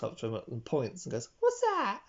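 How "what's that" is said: put-on very high, tiny child voice; little girl voice. A little girl pointing at the nudist, in a squeaky voice. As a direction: pipe it up like a curious little girl. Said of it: "Like my little voice there".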